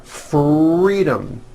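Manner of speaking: FREEDOM with a strong, drawn-out R. Shown as a demonstration. Teacher modelling practice word, strong R in FREEDOM. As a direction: linger on the R in FREEDOM, slowly. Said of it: "with a strong R".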